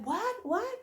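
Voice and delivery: high, puzzled what-what; confused driver. Retold or imitated. The driver, puzzled, can't hear her shouting. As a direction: play the baffled driver. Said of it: "this guy is like".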